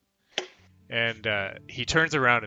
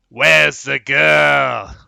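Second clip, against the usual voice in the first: drawn-out, very loud, raised roar; orc's shout; 5 semitones higher, 17 dB louder, much slower than usual. Acted. Orc demanding the missing captive, roaring. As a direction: bellow it across the camp, stretching the words. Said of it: "looks back at the wagon and goes".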